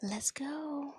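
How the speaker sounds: soft unvoiced whisper. Real, not acted. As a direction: whisper it to yourself, pumped. Whispered self-encouragement.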